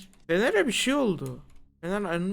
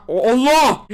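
loud, high yelp of shock; startled cry; 5 semitones higher, 11 dB louder, slower than usual. Real, not acted. Player shocked by a sudden sight in the game, startled yell. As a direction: cry out as if jumped at.

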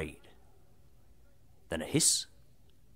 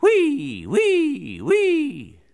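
high, loud whee, falling each time; piercing drill squeal, repeated in bursts; drill noise; 12 semitones higher, 6 dB louder, much slower than usual. Acted. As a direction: imitate a whining drill. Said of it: "and the drill, it went".

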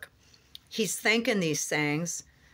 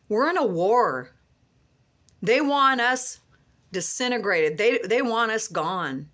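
raised, driving preacher's cadence, hammering each want; fervent preaching; 3 semitones higher than usual. Acted. Preacher rallying his online flock not to give up, fervent preaching. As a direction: preach it like a revival, building and insistent. Preaching to viewers at full force.

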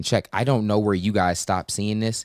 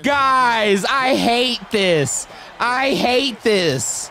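higher, slow dragged-out dread; anxious groan; 12 semitones higher, 3 dB louder, much slower than usual. Real, not acted. Gamer dreading the last seconds with slow anxiety. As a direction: drag it out in tense agony.

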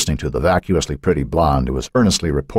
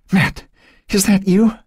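high, fearful question; scared girl seeking a familiar person; frightened; slower than usual. A narrator's reading. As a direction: call out anxiously in the dark. Frightened captive just ungagged in dark.

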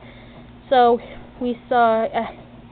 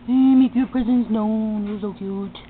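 talking to a cat; slower than usual. Real, not acted. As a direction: coo gently at the kitty.

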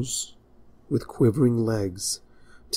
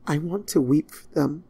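muffled grief; 6 semitones higher than usual. Acted. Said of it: "her voice muffled under her hooves".

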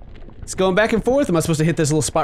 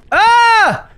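short, loud high shriek; sharp high "ah" of fright; startled scream; 7 dB louder than usual. Real, not acted. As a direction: cry out in sudden alarm. Worm attacked in game.

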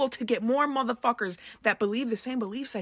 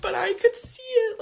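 crying; 8 semitones higher than usual. Real, not acted. Young woman crying to camera about her parents. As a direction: voice cracks high through tears. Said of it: "Forgive me for being a little emotional here".

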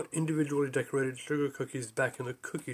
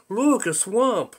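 excited exclamation; 6 semitones higher, 7 dB louder, much slower than usual. Acted.

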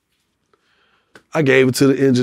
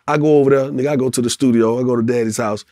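ordinary talk, nothing marked

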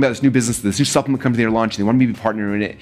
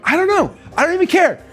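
much higher and louder, brushing the question off; spiteful defiance; 11 semitones higher, 4 dB louder than usual. Retold or imitated. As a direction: shrug off the question, fixated on beating them. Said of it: "built out of spite".

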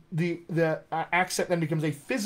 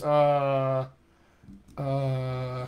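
low, drawn-out General American "uh"; General American hesitation; 4 semitones lower than usual. Shown as a demonstration. Coach shows his own thinking sound, General American hesitation. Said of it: "That's my general American vocal tract posture".

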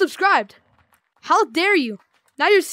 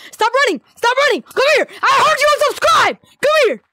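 playful chase yell; 8 semitones higher, 5 dB louder than usual. Acted. Chasing a player who unsubscribed.

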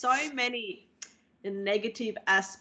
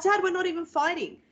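protesting; 7 semitones higher than usual. Retold or imitated. Daughter protesting to dad.

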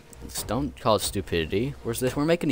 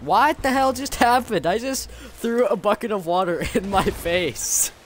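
1 startled outburst; 9 semitones higher, 3 dB louder, slower than usual. Real, not acted. Startled by water in face.

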